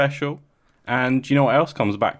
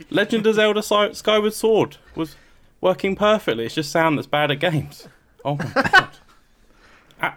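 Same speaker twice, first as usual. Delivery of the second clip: hammed-up alarmed host; 10 semitones higher than usual. Acting out a threatening call.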